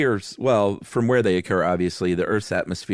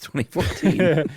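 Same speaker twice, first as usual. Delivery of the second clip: laughing; 9 semitones higher than usual. Real, not acted. Laughing at own obsession.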